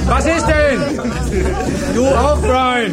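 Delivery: brisk classroom greeting, projected voice; teacher calling the class. Acted. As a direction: call the room to order from the front. Teacher calls the class to order.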